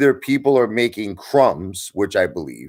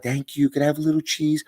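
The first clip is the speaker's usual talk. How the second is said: higher, softer squeaky mouse voice; grateful little mouse; 8 semitones higher, 4 dB quieter than usual. Retold or imitated. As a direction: play a meek mouse begging for cheese. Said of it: "the mouse is like".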